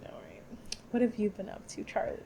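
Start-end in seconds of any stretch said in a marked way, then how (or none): 0.1-0.4 whispered aside. Real, not acted. Whispered transition.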